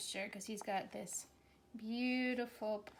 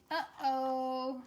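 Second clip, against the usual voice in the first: spider saying uh-oh. Acted. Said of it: "he's saying".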